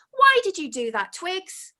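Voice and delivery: high indignant demand; furious. Acted. Penelope rounding on Twigs, high and indignant. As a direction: confront her, outraged. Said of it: "turned round furiously".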